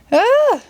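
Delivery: sudden high frightened scream. Acted. Children screaming as the spaceship dives at them, high scream. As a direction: shriek as the ship dives.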